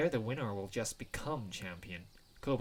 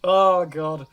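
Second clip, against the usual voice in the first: very loud, higher "oh God"; loud groaned exclamation; 4 semitones higher, 17 dB louder than usual. Real, not acted. Player reacting after the gobbling noise, loud exclamation. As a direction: blurt it out at full volume.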